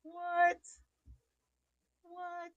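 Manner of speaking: high, drawn-out thrilled voice; high gushing to daughter about the boil; mock disbelief. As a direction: act out being blown away. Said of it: "I'm like".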